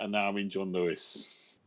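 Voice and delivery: ordinary delivery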